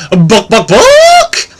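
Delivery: clucking book book, then a very high loud squawk; chicken voice. Acted. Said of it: "the chicken comes back and goes".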